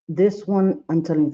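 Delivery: normal talking voice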